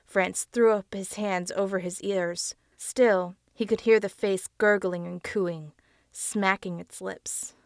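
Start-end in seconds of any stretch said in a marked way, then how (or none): none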